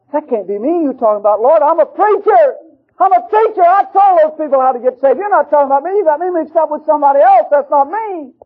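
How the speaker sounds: high, loud protesting plea; frantic pleading. Retold or imitated. Preacher protesting to God, high desperate plea. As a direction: beg him it isn't you, voice climbing.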